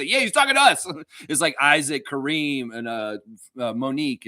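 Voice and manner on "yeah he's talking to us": mock chat chiming in.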